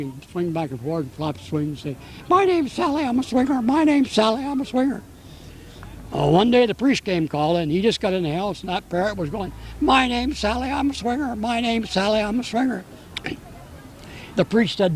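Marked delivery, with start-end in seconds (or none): 2.3-5.0 higher-pitched parrot imitation. Joke teller voicing the parrot for the interviewer, high parrot voice. 9.8-12.8 lightly higher parrot imitation. Parrot greeting the visiting priest, lightly voiced parrot.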